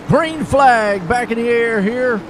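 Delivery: green flag restart called out with a lift; play-by-play call. Acted. Commentator calling the green flag restart for viewers.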